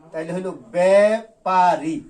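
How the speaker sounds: ব্যাপারী drawn out slowly and loud, plain এ; teacher contrasting ব্যাপারী with ব্যাপক; slow clear demo. Shown as a demonstration. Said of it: "যদি একার দেয়া হয় শুধু".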